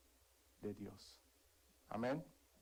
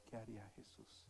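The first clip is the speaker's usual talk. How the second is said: inner voice; 13 dB quieter than usual. Retold or imitated. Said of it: "vino a mi corazón esa palabra".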